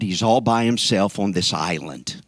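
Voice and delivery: ordinary talk, nothing marked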